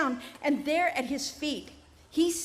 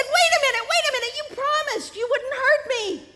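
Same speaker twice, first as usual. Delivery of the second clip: much higher, louder, frantic plea; panicked protest; 11 semitones higher, 4 dB louder than usual. Acted. Man panicking at the snake, high and frantic. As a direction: cry out in alarm.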